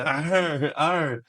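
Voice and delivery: hammed-up effeminate lion growl; camp lion roar. Auditioner playing a "bading" lion, high camp roar. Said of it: "Bading na lion".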